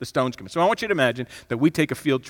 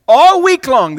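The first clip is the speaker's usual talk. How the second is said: emphatic repeat; 10 semitones higher, 11 dB louder, slower than usual. Real, not acted.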